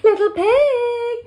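high, loud, drawn-out call. A narrator's reading. Wolf outside the brick house, calling out. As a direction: sing out to the pig, stretching the last word. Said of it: "called the wolf".

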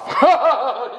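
a quick high burst of laughter. Real, not acted. Laughing at his own boast to viewers, high burst of laughter. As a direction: laugh at your own joke.

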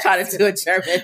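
mock German accent. Shown as a demonstration. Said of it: "You're trying to do your German accent".

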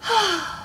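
slow audible breath out; deep thoughtful breath. Shown as a demonstration. Storyteller breathes with the children, thoughtfully. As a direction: let out a long breath. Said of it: "Everyone take a breath".

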